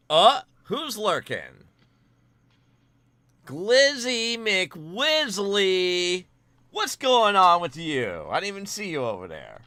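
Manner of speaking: high, loud, drawn-out hail; excited greeting. Real, not acted. Streamer spots a viewer in chat, excited shouted greeting. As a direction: greet them with a big, playful holler.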